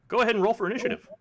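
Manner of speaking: loud, fast call to roll initiative; excited. Acted. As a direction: bark out the combat start. GM springs combat, loud and fast.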